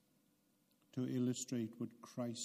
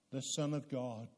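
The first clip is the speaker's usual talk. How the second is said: ordinary talk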